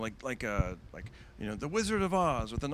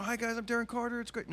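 higher, bright emcee hello; stage greeting; 12 semitones higher than usual. Retold or imitated. Young comic greets open mic crowd, higher emcee voice. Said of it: "I go out there and I go".

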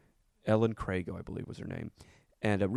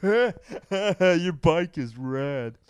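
mock taunt; 8 semitones higher, 9 dB louder, much slower than usual. Mocking kid who fell for joke.

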